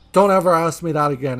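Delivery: loud, sharp retelling of an angry customer; aggressive retort. Retold or imitated. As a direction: snap it back like an offended old lady. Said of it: "she said it in such an aggressive manner".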